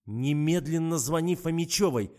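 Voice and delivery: commanding order. A narrator's reading. Investigator orders the driver to phone, loud commanding. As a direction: order it forcefully, at full voice. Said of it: "приказала я".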